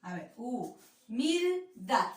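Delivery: humildad slow, syllables pulled apart. Shown as a demonstration. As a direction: sound out humildad slowly. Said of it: "Cuál serían las sílabas".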